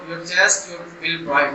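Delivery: normal talking voice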